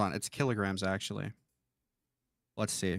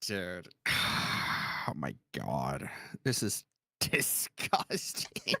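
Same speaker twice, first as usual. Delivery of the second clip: disgusted; 7 semitones lower, 4 dB quieter, much slower than usual. Real, not acted. Disgusted at the video.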